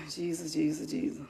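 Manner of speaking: Jesus sighed out low, soft and breaking; a near-sob plea under the breath; despairing sigh. Real, not acted. Angry parent sighing to herself, breaking. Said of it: "my legs shaking out".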